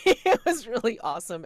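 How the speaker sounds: high, loud giggle through the words; laughing praise. Real, not acted. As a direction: let laughter burst into the praise. Laughs while praising the show.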